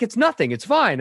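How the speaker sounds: imitating a husband deflecting in an argument; defensive brush-off. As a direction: snap it off to shut her down. Husband brushing her off, escalating.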